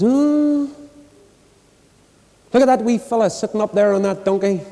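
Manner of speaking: high, mildly hammed-up disapproval; disapproving passer-by. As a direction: exclaim as a scandalised onlooker. Passer-by scolding in a retold fable.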